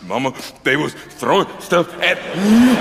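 hammed-up tearful child's whine, halting; whimpering to mama. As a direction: bleat it to mama in mock distress, breaking it up. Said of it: "I was like a little bitch on the phone to my parents".

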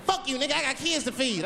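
squeaky defiant infant; high kid voice snapping back; high baby voice. Retold or imitated. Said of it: "He said".

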